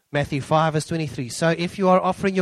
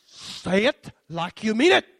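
stern mother; 6 semitones higher, 4 dB louder, slower than usual. Retold or imitated. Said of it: "she stands with a wooden spoon".

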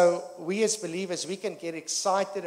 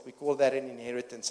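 normal talking voice

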